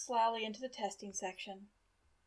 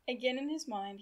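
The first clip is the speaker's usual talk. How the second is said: ordinary delivery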